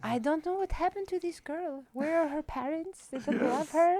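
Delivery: gentle worried mom gossip; imitating sweet mom on a pop star; soft-spoken sweet mom. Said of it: "soft-spoken and sweet".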